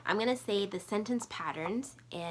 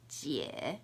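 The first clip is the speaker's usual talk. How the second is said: usual speaking voice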